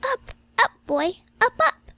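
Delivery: put-on high little child's voice calling someone awake; a small child's high calling voice. A narrator's reading. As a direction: call up high like a small child tugging at him. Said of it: "a little voice calling in my ear".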